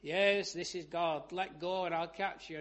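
loud, booming answer from above, easing off; voice of God. Retold or imitated. God answering the man on the cliff, voice from above. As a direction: imitate God calling down. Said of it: "And this voice said".

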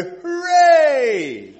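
high, loud, drawn-out hooray; whooping, falling cheer; big cheer. Acted. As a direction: cheer like a proud parent over a tiny triumph. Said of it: "we all go".

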